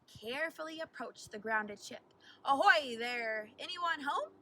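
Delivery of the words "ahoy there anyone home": calling out. A narrator's reading. Teacher calls up to the stranded pirate ship, loud. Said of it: "shouted Miss Smith".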